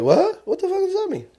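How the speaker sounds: squeaky high baffled outburst. Retold or imitated. Confused audience member reacting to nonsense, squeaky and high. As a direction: jump way up in pitch, baffled. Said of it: "You're like".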